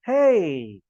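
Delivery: "हे" starting high and loud, then gliding down; high-to-low intonation. Shown as a demonstration. Teacher showing students intonation, "हे" falling high to low. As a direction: let "हे" swoop from high pitch to low. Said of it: "high pitch से जाता है वो low pitch पर".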